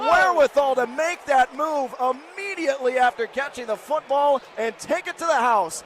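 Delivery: high, loud play-by-play shout; excited touchdown call. Real, not acted. Play-by-play announcer shouting a Notre Dame touchdown. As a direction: call the score like the crowd just erupted.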